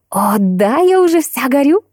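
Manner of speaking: rising to a high, eager cry; excited exclamation. A narrator's reading. Friend hearing the boy's name, exclaiming eagerly. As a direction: exclaim it with climbing pitch. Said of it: "воскликнула Биби".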